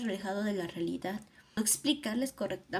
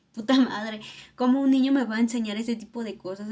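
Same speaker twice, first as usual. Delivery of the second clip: stunned outburst; 8 dB louder than usual. Retold or imitated. Stunned inner thought about child.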